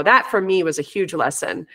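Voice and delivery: usual speaking voice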